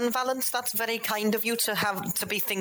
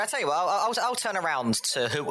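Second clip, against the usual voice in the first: usual speaking voice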